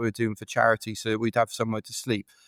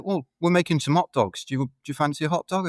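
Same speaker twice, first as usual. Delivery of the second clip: friendly local; 6 semitones higher than usual. Retold or imitated. Local offers hot dogs.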